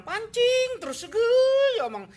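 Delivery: high, slow, straining indignation on pancing terus; mock outrage. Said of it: "Pancing terus emosi orang". (pancing terus dibentak tinggi dan lambat)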